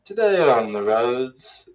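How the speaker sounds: slow traffic-report announcement, drawn out; drawn-out mock traffic announcer; mock traffic reporter. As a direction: open a radio traffic bulletin in jest. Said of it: "read the traffic reports".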